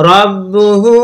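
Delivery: "রব্বুহু" chanted with a long final "হু"; drawn-out ending. Shown as a demonstration. Teacher models four-alif length, "রব্বুহু" drawn out. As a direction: hold the last vowel of "রব্বুহু". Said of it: "এখানে টেনে পড়তে হবে".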